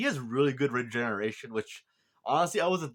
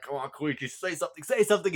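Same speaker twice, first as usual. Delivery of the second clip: pleading that climbs higher and louder; urging; 7 semitones higher, 3 dB louder than usual. Retold or imitated. Viewer willing Koichi to speak up, impatient pleading. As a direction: egg him on, building in pitch.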